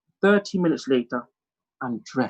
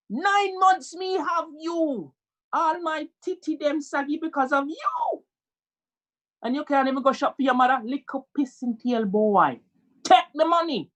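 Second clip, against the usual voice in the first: hammed-up guilt-tripping mum, pitched up; scolding Jamaican mother; 11 semitones higher than usual. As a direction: berate your son in Jamaican patois. Jamaican mother scolds her son.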